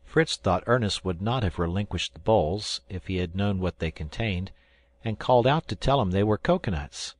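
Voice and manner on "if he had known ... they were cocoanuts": ordinary delivery, nothing marked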